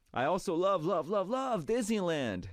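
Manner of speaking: high-pitched gush, drawing out each love; gushing enthusiasm. Acted. Reading a fan's message about Disneyland on air, gushing. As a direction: read it like a thrilled superfan.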